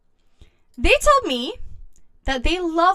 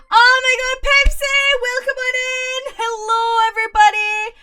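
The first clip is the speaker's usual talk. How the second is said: loud, much higher hello to the crowd; excited greeting; 11 semitones higher, 6 dB louder than usual. Real, not acted. Streamer welcoming viewers in, loud and bright. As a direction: bounce in and welcome everyone.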